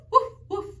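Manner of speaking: usual speaking voice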